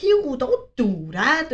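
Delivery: slow, drawn-out mock scolding; lightly voiced mother, stretching each word; mother scolding child. Mother caught in bathroom by robot child, slow mock scolding. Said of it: "and she's like".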